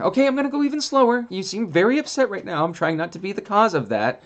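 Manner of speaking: very high, gentle mock dog appeasing; a timid dog soothing someone upset; dog's voice. Dog's imagined reply.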